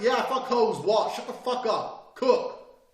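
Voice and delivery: loud, high yelled retort; arguing back. Acted. Man arguing with his girl, yelling back. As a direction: act out a shouting match comeback. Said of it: "Blah, blah, blah, arguing".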